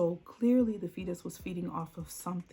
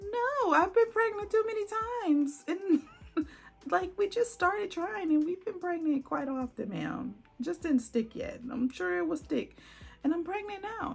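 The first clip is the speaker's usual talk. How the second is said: high, loud, slowed protest; pitching up to plead she is pregnant; exasperated insisting; 12 semitones higher, 5 dB louder than usual. Retold or imitated. Pregnant woman insisting to staff she is pregnant, high exasperated protest.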